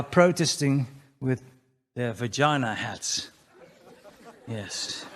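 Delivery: ordinary talk, nothing marked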